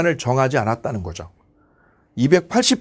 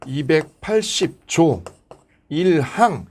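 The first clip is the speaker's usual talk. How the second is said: slow, measured article citation; formal statute reading; much slower than usual. Shown as a demonstration. Teacher shows how to read article numbers, slow formal citation. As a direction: read each legal unit out separately, stretched. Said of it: "이렇게 읽어주는 게 정통이에요".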